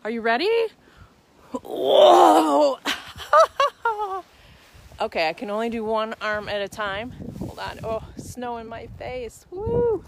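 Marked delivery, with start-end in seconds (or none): 1.5-4.2 loud whoa then laughing shout; falling into snow. Real, not acted. Dropping into snow for angel. 9.5-10.0 loud, high woo; excited whoop. Real, not acted. Making the snow angel.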